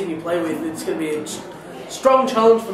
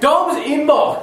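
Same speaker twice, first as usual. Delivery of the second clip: slow, high segment announcement; announcer voice; 6 semitones higher, slower than usual. Acted. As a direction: announce Dom's inbox grandly.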